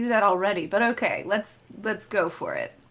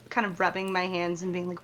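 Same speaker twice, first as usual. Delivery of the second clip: ordinary talk, nothing marked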